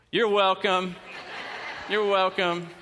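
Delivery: loud, smug you're welcome; mock gracious. Pastor wrongly thanked for a song, loud and smugly gracious. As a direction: accept the praise with a laughing wink. Taking credit for Quinn's song.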